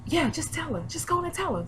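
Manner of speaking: high, hushed urging; whispering coworker. Retold or imitated. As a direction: imitate a coworker nudging her on under the breath. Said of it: "the other person who was working with her was like".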